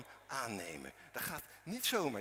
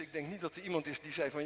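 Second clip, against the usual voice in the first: ordinary delivery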